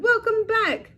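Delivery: high excited shout. Acted. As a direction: shout it from the cage top.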